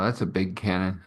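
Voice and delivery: ordinary talk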